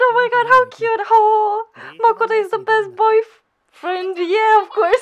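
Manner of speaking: high, loud fangirl gush; real delighted squealing; excited gushing. Real, not acted. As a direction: gush over a cute favourite. Gushing over favourite anime character.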